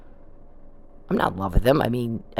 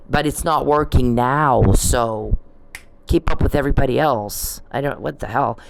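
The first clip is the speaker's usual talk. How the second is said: usual speaking voice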